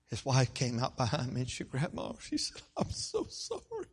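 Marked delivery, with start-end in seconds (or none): 2.8-3.9 high, slow imitated tearful apology. Wife apologizing to pastor in the hall, tearful. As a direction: apologize as a wife close to sobbing.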